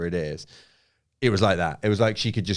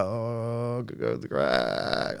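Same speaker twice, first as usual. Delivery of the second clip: voice of crack; 2 semitones higher, 5 dB quieter, much slower than usual. Retold or imitated. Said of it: "Crack was like".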